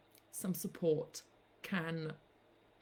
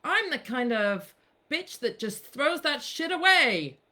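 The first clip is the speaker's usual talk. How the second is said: a louder, higher, brassy declaration; sassy quoted line; 5 semitones higher, 7 dB louder than usual. Pretend. Quoting herself to the listeners, brash and louder. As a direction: belt it out like a proud boast. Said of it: "while I say".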